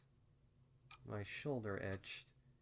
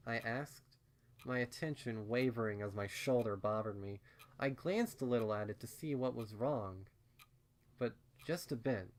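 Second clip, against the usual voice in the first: plain reading voice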